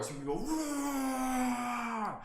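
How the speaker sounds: high, slow drawn-out roar; dragon roar. Shown as a demonstration. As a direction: stretch the roar out long and high. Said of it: "inhale and then we're gonna roar".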